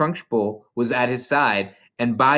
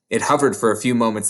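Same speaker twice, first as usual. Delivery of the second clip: plain reading voice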